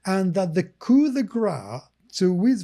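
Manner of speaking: ordinary talk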